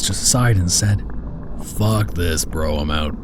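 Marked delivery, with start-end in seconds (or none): 1.6-3.2 deep gruff voice. A narrator's reading. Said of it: "Tom, in his deep, gruff voice".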